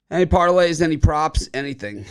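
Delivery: loud, high-pitched call to the panel. Real, not acted. Host asking co-hosts for their bets, calling out. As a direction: throw it out loudly to the group.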